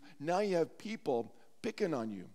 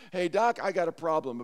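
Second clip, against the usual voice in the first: loud, cocky call to the doctor; retold brash announcement; acted challenge; 3 semitones higher, 7 dB louder than usual. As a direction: confront the doc like a town skeptic. Skeptic Joe challenges the doctor.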